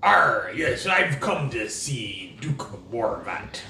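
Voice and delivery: gruff pirate drawl, slow. Acted. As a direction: growl it like an old pirate. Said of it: "doing the pirate voice".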